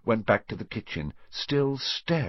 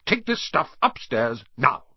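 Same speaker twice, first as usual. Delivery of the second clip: raised, impatient order; snapped; 6 semitones higher, 4 dB louder than usual. A narrator's reading. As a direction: order him off sharply. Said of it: "snapped his uncle".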